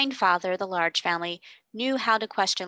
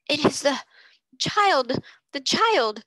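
gasping, higher and louder; gasped out; 8 semitones higher, 6 dB louder, slower than usual. Acted. Invalid recognising the lost child, gasping loudly. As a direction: force the words out between gasps. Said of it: "the invalid gasped".

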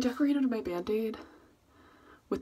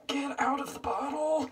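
frustrated yell; 8 semitones higher, 4 dB louder than usual. Real, not acted. Squeezing a clogged dye bottle.

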